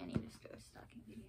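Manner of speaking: whisper. Real, not acted. Whispered repeat to self.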